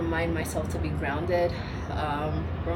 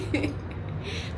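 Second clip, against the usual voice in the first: breathy laugh; 9 semitones higher, 4 dB quieter than usual. Real, not acted. Laughing at herself.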